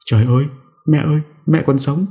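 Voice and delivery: high startled cry to her mother; high, shaken cry of disbelief; shocked calling out. A narrator's reading. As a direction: call out in disbelief, voice leaping up. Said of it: "Bà cất tiếng gọi".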